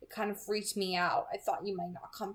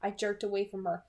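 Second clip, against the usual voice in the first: usual speaking voice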